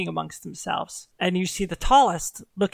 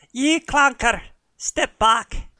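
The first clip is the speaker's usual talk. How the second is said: high, loud brogue ordering him back; a gruff huntress barking an order in a brogue; commanding the armored stranger to step aside, in a loud brogue; Scottish-ish hunter voice; 6 semitones higher, 5 dB louder, slower than usual. Acted. Hunter woman commands paladin.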